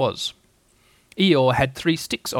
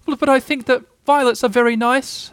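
high, louder squeaky voice; shy Piglet; 11 semitones higher, 6 dB louder than usual. A narrator's reading. Giving violets to Eeyore, a high shy Piglet. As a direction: offer it bravely, little pig.

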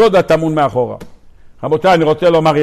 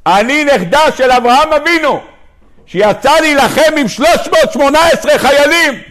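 high, sustained mock outcry; shouty indignant woman; 9 semitones higher than usual. Woman boasts of her lineage to the rabbi, high mock outcry. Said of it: "הגברת הזאת צעקנית".

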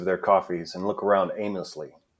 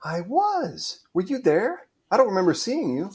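much higher, perky character voice; high, inquisitive stage-play voice; Melanie, curious; 12 semitones higher than usual. Acted. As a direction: ask it with pitched-up surprise. Melanie's reply in read script.

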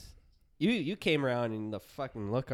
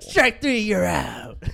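umpire calling strikes; 7 semitones higher, 10 dB louder, slower than usual. Retold or imitated. Umpire calls strikes in story.

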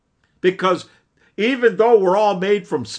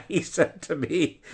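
laughing; 7 dB quieter than usual. Real, not acted. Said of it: "I'm laughing when I think about this".

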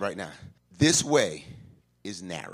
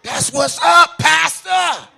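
loud, higher mocking excuse; mock churchgoer shout; 13 semitones higher, 13 dB louder, slower than usual. Pastor mocking a churchgoer's excuse to him, hammed-up shout. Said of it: "this is what we say".

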